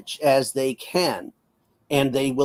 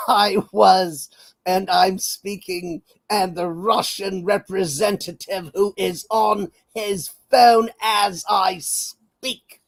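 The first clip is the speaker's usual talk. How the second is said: a hint of a posh British diplomat, slow at the end; lightly voiced plummy British envoy complaining; posh British accent; 6 semitones higher, slower than usual. Mocking Lammy at the UN in a posh British accent. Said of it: "with this posh British accent".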